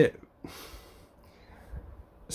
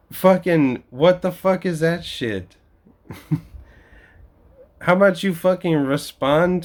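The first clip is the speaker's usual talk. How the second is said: frustrated rant; 5 semitones higher than usual. Real, not acted. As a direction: vent the frustration. Ranting in frustration.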